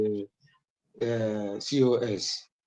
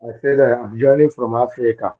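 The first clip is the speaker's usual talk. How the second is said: louder repeat; 8 dB louder than usual. Real, not acted. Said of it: "I can't hear you so loud".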